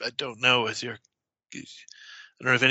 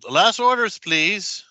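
louder, higher pub call; 8 semitones higher, 6 dB louder than usual. Acted. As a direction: call last orders across the pub. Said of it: "the landlord calls out".

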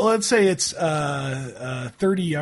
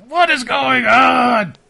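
high, loud, drawn-out outburst; upset shout; 10 semitones higher, 11 dB louder, slower than usual. Acted. Game master voices the man behind the cart, upset shout. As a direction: yell it slowly in outrage. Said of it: "he's getting upset".

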